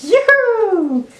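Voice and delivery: sing-song squeal like a game mascot; Mario voice. Retold or imitated. Vlogger acting out Mario's rocket-pack win, squealing high.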